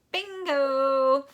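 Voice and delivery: high, loud gleeful yell; triumphant shout. Acted. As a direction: crow it out in triumph. Boy's spitball hits teacher's desk.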